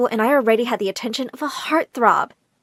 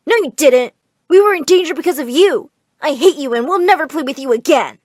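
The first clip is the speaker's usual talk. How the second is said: angry twin; 4 semitones higher, 5 dB louder than usual. Acted. Angry twin shouting at sister.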